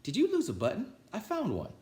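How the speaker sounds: very high, chirpy little bird; sparrow voice. Acted. Sparrow offering Toad a found button, high chirpy voice. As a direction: pipe it up like a tiny helpful bird. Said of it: "said the sparrow".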